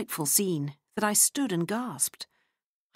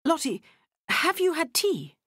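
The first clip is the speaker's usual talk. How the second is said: feverish; 11 semitones higher than usual. A narrator's reading. Narrator trying to calm the quarrel, feverish. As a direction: hurry to change the subject nervously. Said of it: "I began feverishly".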